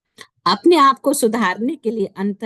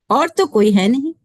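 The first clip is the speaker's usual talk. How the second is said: emphatic declaration; 5 semitones higher, 6 dB louder than usual. Shown as a demonstration. First take of repeated line.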